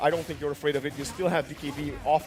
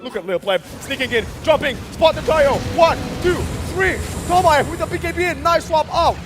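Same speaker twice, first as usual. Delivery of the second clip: excited esports caster call; play-by-play hype; 11 semitones higher, 8 dB louder than usual. Real, not acted. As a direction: shout the fight call live.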